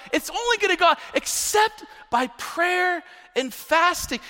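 high, forceful sermon delivery; impassioned preaching. Real, not acted. Preacher urging congregation on the hardest cases, impassioned preaching. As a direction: preach it hard, pitched well up.

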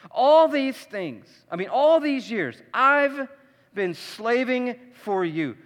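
an exasperated son airing his grievance; exasperated complaint. Retold or imitated. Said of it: "He's really exasperated".

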